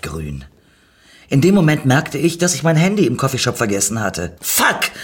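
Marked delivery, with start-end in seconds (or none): 4.4-4.9 high, sharp cry of dismay; exclaimed curse. A narrator's reading. Narrator realises the phone is left behind, exclaimed curse. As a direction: call out the curse, pitched high. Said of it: "rief ich".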